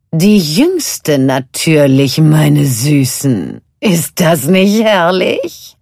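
slow, loud purring witch delight; gloating sea witch. A narrator's reading. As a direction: relish it like a wicked sea witch. Ursula gloats to sisters. (genüsslich triumphierende Seehexe)

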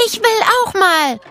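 put-on high girl's voice calling out; a lightly voiced child's eager call. A narrator's reading. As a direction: Nele calls out, wanting her turn. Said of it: "rief Nele".

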